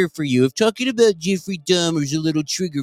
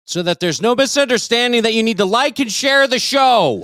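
mock-stern shouted plug; 8 semitones higher, slower than usual. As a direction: break the hush with a drawn-out holler. Loud plug after mock silence.

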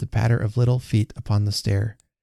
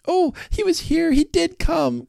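put-on very high, breathless-with-news child; excited girl's cry. A narrator's reading. Alice telling her mother, excited cry. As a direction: burst out in a high little-girl voice. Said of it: "cried Alice".

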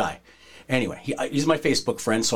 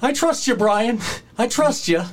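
high, nervous pleading voice; mock a scared man trusting his friend; nervous plea; 9 semitones higher than usual. Hurt wrestler nervously telling Brian he trusts him, high pleading. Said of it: "you can tell he's nervous".

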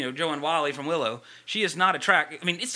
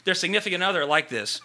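ordinary delivery

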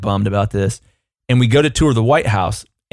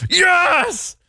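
much higher, exultant yelps of victory; triumphant cheer; much slower than usual. Retold or imitated. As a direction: crow with glee like you just won. Triumph at getting screwdrivers back.

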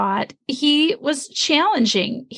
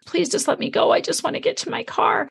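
higher, half-whispered plea to let her pass; pleading inner thought; 6 semitones higher than usual. Retold or imitated. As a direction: beg the car silently to let you go. Frantic silent plea at driver.

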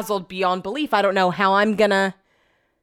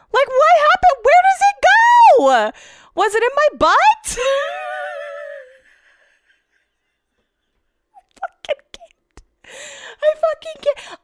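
high, squeaking laugh-cry through the words; breaking into hysterical giggles; real helpless laughter; 3 dB louder than usual. Real, not acted. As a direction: laugh till it almost turns to tears. Laughing hysterically at own mishap.

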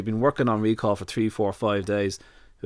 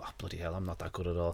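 much softer, lower deflated aside; dismayed mutter; 5 semitones lower, 13 dB quieter than usual. Retold or imitated. Amateur meeting real trainers, soft and deflated. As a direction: mutter it to yourself, humbled. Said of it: "you go".

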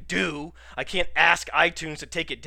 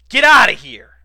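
high, loud dismissive burst; scoffing shout; 8 semitones higher, 17 dB louder than usual. Pretend. Podcaster dismissing doubts about his bird impersonations, scoffing shout.

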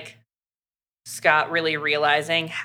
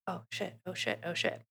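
panicked whisper; 15 dB quieter than usual. Retold or imitated. Scott panics as she leaves.

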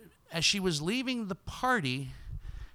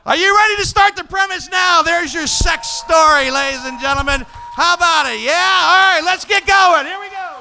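high, shouted show-opening call; rally the crowd at full blast; roaring master of ceremonies; hyped-up host; 11 semitones higher, 13 dB louder than usual. Real, not acted. Host closing story, starting the show.

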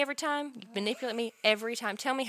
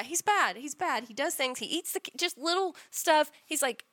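normal talking voice

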